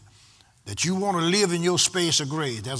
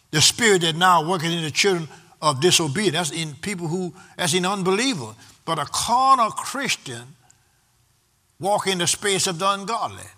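usual speaking voice

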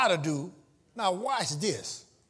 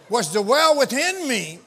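slow, drawn-out reading; slow preached scripture; 4 semitones higher, 5 dB louder, slower than usual. Real, not acted. Preacher repeating the verse to the church, slowly. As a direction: stretch each word of the verse.

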